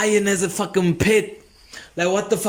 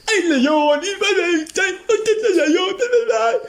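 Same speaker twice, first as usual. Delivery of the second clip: squealing excited crowd; 12 semitones higher than usual. Retold or imitated. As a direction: squeal like thrilled gawkers at the gate. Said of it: "Oh, they don't sound like that".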